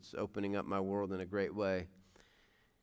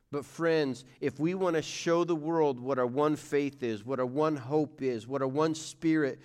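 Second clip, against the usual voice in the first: louder and higher, a building preacher's climax; preaching at full force; 5 semitones higher, 6 dB louder than usual. Acted. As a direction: rise to a full-voiced call to the church.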